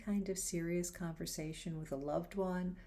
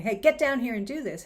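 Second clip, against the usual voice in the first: barked order, much louder and higher; harsh bossy command; 5 semitones higher, 11 dB louder than usual. Retold or imitated. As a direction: snap the order at the kids. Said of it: "Is there another way of communicating something?".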